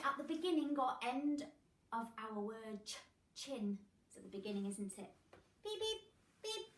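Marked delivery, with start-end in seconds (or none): 5.6-6.7 machine beeps. Pretend.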